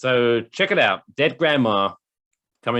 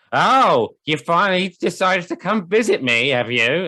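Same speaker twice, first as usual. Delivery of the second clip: put-on raised, louder guilt-tripping old woman; dead grandma scolding through Alexa, higher and louder; nagging grandmother; 8 semitones higher, 5 dB louder than usual. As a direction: needle him like a naggy grandma. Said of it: "Alexa's gonna be like".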